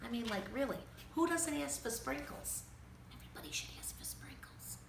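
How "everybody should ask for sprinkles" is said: quick unvoiced whisper, softer; whispered aside. Reader telling the kids a secret, whispering fast. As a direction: hurry it out as a secret. Aside after the story line.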